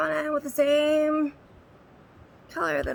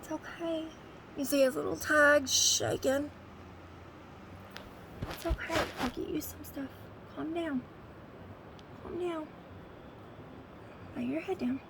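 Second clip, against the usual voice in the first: soothing the dog; 5 dB quieter than usual. Real, not acted. Owner hushing her scared, shaking dog with medicine promised, soft and soothing. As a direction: gently settle a trembling pet.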